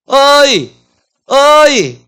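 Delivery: high, loud wordless splutter; flustered husband. Acted. Husband failing to answer his wife back, agitated exclamations. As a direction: act a man too worked up to find words. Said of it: "남자가 막 흥분했을 때".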